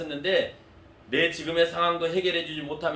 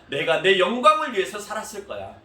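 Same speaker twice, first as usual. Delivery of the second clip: forceful rebuttal; 4 semitones higher, 7 dB louder than usual. Retold or imitated. Jesus rebutting accusers.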